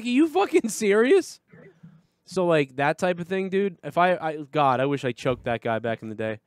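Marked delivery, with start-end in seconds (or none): 0.0-1.4 high-pitched, indignant disbelief; retold incredulous reply. Guest answering friend mad about crumbs, retold incredulity. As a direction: throw it back in mock disbelief.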